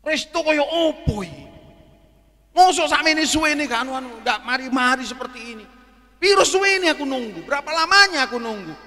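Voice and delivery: omelan tinggi dan sangat keras; marah-marah. Retold or imitated. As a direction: tirukan pelanggan membentak karena lama menunggu. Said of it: "Jangan marah-marah". (tiruan pelanggan ngamuk di restoran)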